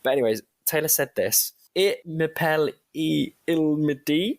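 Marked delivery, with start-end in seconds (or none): 1.7-4.4 slow, higher attempt at French with an English accent; bad French accent. Shown as a demonstration. English podcaster apologising, then attempting Taylor's French line. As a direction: try the French line, self-consciously slow. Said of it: "this is going to sound atrocious".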